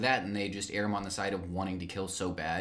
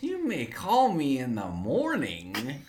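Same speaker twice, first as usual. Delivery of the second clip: slow mock villain; 4 semitones higher, 4 dB louder, much slower than usual.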